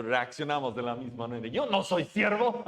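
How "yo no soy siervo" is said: laughing, higher and a bit louder, in mock protest; joking defiance. Preacher mocks the congregation's reaction, laughing. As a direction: toss it off with a laugh, half joking. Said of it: "en broma le decimos".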